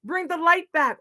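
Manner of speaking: high, loud cheers of encouragement; cheering on. A narrator's reading. Animals send Raccoon off to bring back warmth, cheering. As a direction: urge the hero on, pitched high.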